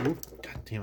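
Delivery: ordinary delivery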